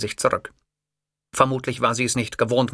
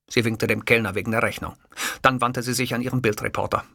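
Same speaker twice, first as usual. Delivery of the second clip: usual speaking voice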